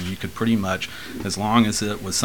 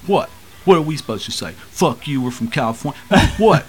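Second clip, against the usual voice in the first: mock challenge; 6 semitones higher, 4 dB louder than usual. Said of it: "challenging us and just going".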